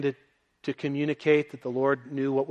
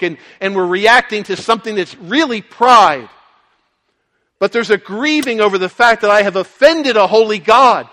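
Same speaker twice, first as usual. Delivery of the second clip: higher and louder, driving each phrase home; preaching at full force; 7 semitones higher, 13 dB louder than usual. Acted. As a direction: preach the climax with full conviction.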